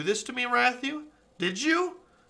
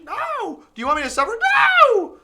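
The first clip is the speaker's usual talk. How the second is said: very high, loud shout; screamed denial; 11 dB louder than usual. Real, not acted. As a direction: scream no at the screen.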